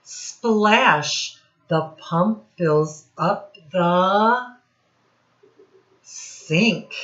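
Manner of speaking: lively read-aloud, sound words popped out; reading to children. Acted. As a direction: read it to a class of little kids, playing up the noises. Teacher reads rhyming picture book.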